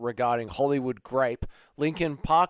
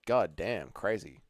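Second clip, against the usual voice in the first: usual speaking voice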